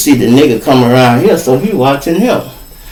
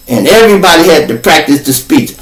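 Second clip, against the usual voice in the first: ordinary delivery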